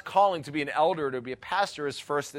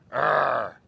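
short growled arr like a wolf; mock wolf growl; 3 semitones lower, 5 dB louder than usual. Pastor imitating a masked girl growling at him. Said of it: "all she do is she go".

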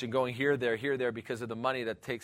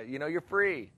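ordinary talk, nothing marked

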